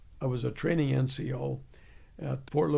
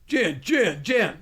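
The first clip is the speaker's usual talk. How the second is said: loud repeated calling, pitched up; 5 semitones higher, 6 dB louder, slower than usual. Retold or imitated. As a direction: bark the name across the company. Sergeant calls him out.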